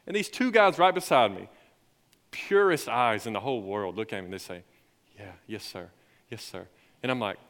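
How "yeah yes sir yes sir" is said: imitating a hushed, lower, sincere reply; low, soft retold yes; quiet assent. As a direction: answer very softly, like a humbled student. Two students' sincere retold answer.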